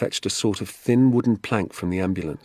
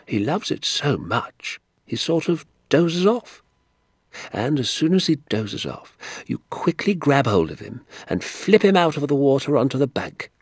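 doctor relishing his secret; 8 semitones higher than usual. Acted. Doctor teaching Danny to tickle trout, relishing every step. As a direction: build the suspense step by step.

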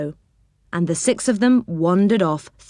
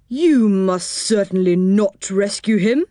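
stern, cross policeman; lightly voiced angry policeman. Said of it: "said the policeman angrily".

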